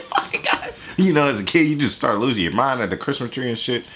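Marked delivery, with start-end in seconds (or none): 0.0-0.7 whispered, awestruck curse; breathless awe. Retold or imitated. A kid overwhelmed by a Christmas present, an awestruck whisper. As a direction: gasp it out in disbelief at the gift. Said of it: "I'm like".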